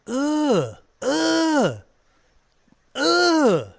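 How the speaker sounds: long high drawn-out ugh; disgusted groans. Retold or imitated. Streamer groaning in mock disgust at foreign hunters. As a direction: groan like it's beneath you.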